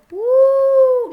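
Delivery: high, loud sudden oooh; loud shocked ooo; startled whoop. Real, not acted. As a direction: react to a crash on screen. Car crashes in the game.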